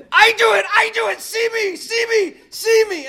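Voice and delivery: very high, loud imitated child's scream; screaming little girl. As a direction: scream like a toddler demanding to be seen. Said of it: "screaming at this lady".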